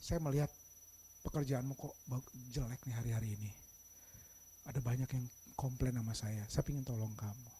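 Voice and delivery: low, soft-spoken boss; quiet, measured private reprimand; gentle correction. Shown as a demonstration. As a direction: correct calmly, voice dropped, offering help. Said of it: "tapi beda caranya".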